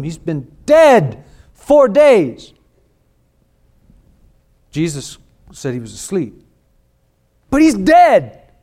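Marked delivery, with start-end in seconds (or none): 0.0-2.5 loud, high, slow protest as Martha. Acted. Martha objecting to Jesus at the tomb, loud incredulous protest. 7.5-8.5 loud, high, slow protest as Martha. Acted. Martha objecting to Jesus at the tomb, loud incredulous protest.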